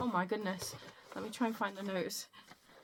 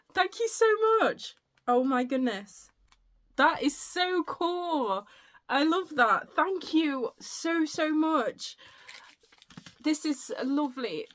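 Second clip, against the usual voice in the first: high, loud gushing with stretched words; overjoyed gratitude; 7 semitones higher, 6 dB louder than usual. Real, not acted. Vlogger thanking a friend for a puzzle book, overjoyed. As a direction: squeal your thanks, lingering on each phrase.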